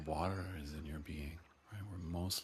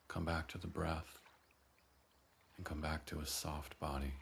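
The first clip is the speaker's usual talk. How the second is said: ordinary talk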